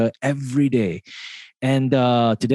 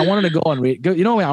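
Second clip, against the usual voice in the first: normal talking voice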